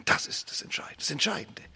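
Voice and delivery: hushed, intimate emphasis; whisper. Acted. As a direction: whisper the key point to the congregation. Preacher hushes key point.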